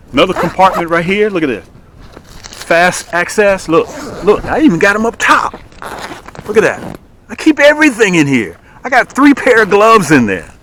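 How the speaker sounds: showing off the tackle box; excited. Real, not acted. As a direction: sell it with real energy. Excited product showcase.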